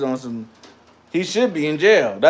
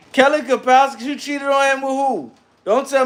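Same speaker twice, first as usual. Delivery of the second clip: incredulous; 10 semitones higher than usual. Real, not acted. Incredulous at Kelly cheating.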